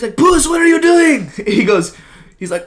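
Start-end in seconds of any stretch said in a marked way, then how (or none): 0.2-1.3 high, loud, slow alarmed call; mock Shrek shouting to Puss; urgent Shrek cry.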